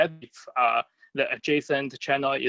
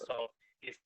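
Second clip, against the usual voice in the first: breaking up; 12 dB quieter than usual. Real, not acted. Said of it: "I think you're breaking up".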